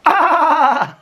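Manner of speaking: loud, very high fake cackle; mocking fake laugh. Mimicking Alex to his face, mock cackle. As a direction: blast a fake laugh right at him. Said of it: "stand in front of him and I go".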